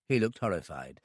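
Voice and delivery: ordinary delivery, nothing marked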